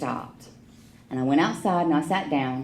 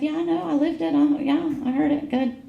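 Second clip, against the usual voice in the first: much higher, snippy retort; defensive brush-off; 11 semitones higher than usual. Retold or imitated. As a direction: brush the sponsor off, high and curt. Defensive reply to sponsor.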